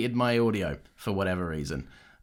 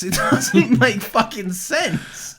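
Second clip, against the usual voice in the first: laughing protest; 7 semitones higher, 5 dB louder, much slower than usual. Real, not acted. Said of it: "But it's bollocks".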